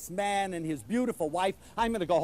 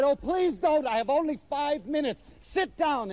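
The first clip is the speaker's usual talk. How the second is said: hurried protest; 7 semitones higher than usual. Retold or imitated. He hurriedly refuses.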